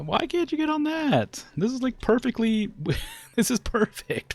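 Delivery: very high, loud incredulous whine; exasperated squeal. Real, not acted. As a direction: squeak out the disbelief. Disbelief at pathing.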